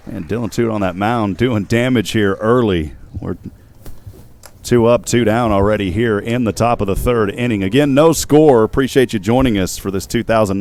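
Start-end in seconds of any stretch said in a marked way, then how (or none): none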